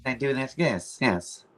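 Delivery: pinched-nose nasal voice. Shown as a demonstration. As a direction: talk holding your nose shut. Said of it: "hold my nose like this so I can talk like Bob".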